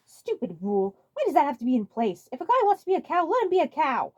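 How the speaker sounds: high, loud indignant rant; sulky rant. Acted. Waluigi sulking on a fence, high indignant rant. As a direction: vent your grievance. Waluigi ranting alone after expulsion.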